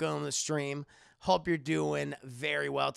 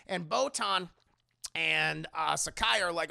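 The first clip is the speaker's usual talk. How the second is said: normal talking voice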